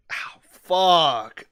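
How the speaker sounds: sharp, loud yelp of sudden pain; real ow of pain, high and loud. Real, not acted. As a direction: cry out after biting your cheek hard. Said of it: "I bit the inside of my cheek".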